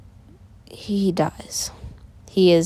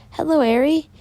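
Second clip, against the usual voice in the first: high, lilting mother voice; mother's greeting; 9 semitones higher than usual. Acted. Mother greets Eri as she comes downstairs, high and lilting. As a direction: greet the child in a raised, singsong pitch.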